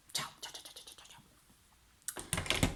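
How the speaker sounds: whispery string of goodbyes; soft, voiceless repeated byes trailing away; fading goodbyes. Real, not acted. Signing off from side conversation.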